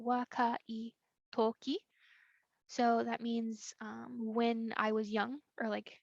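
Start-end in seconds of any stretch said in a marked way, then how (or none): 0.1-1.8 wakai said slowly, then a pause before toki; slow breakdown. Shown as a demonstration. Teacher breaks the example down.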